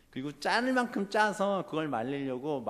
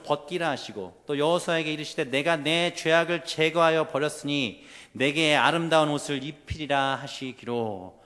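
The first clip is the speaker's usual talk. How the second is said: louder, measured formal recitation; scripture reading; 2 semitones higher, 7 dB louder than usual. Acted. Preacher reads Zechariah to the congregation, formal proclamation. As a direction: read the verse out firmly to the congregation. Reads a Bible verse aloud.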